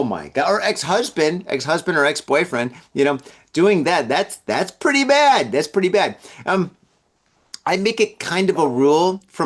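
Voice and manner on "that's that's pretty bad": high, emphatic exclamation; emphatic judgement. Acted. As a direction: push the verdict up high. Emphatic take, then toned down.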